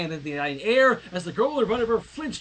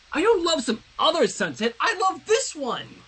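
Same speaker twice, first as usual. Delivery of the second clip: a raised, higher-pitched cry of protest; mild yell; 9 semitones higher, 3 dB louder than usual. Acted. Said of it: "Twilight yelled".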